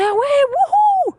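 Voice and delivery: high, loud whoop of excitement; excited cheer. Real, not acted. Mum announcing the visitor center outing, excited cheer. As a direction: cheer it out, pitch leaping up.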